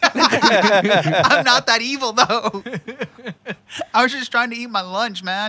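high, loud laughing protest. Real, not acted. Answering friend's harsh joke, laughing hard. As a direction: laugh it off, pleading innocence.

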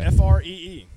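each letter shouted out loud; spelled-out shout. Acted. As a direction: bark out every letter. Spelling out free for emphasis.

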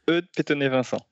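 "Eudes Petonnet Vincent" in French, ending in nasal van-sawn; native French name. Shown as a demonstration. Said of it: "Impossible to pronounce for English-speaking people".

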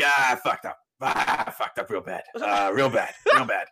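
lightly voiced drunk mumble, laughing at the end; a hint of a sloppy drunk groaning out an apology; drunk Aaron impression. Drunk roommate admitting to Greg, lightly slurred and laughing. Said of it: "pretty good fake name Aaron impression".

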